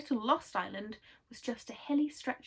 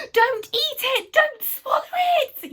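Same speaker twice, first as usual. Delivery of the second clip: very high, loud, slow yell of alarm; panicked yell; 12 dB louder than usual. Acted. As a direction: shriek the warning in panic. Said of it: "yelled Moonface suddenly".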